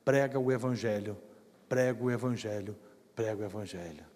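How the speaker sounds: low, hushed, tender voice of the Spirit; sweet voice. Retold or imitated. Preacher recalls the Spirit speaking to his heart, sweet and hushed. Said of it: "doce voz do Espírito".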